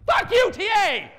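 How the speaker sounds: high, loud outburst; angry shout. Acted. Speaker blowing up at a Unix command, angry shout. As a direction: explode at the useless program.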